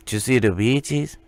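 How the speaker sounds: light mock Mexican accent; Mexican-accented English. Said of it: "he said more like".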